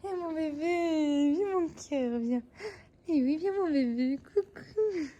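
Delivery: high, slow sing-song coaxing. Real, not acted. To the cat climbing on her, high cooing baby talk. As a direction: melt over a pet.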